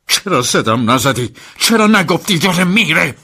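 loud, furious accusation climbing high; furious shout. Acted. Master on the balcony yelling at his driver, furious. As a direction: yell it at him, building to a peak.

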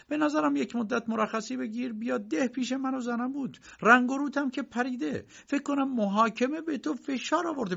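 high, insistent coaxing uncle. Acted. Uncle urging Josef to come to the village, high and insistent. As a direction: urge him to the village.

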